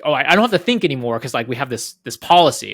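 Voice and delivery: smug PM. Retold or imitated. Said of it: "That, like".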